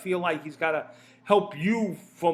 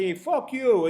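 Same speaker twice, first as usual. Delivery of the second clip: mock angry comeback; 5 semitones higher, 6 dB louder, slower than usual. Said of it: "get fucking pissed at him and say".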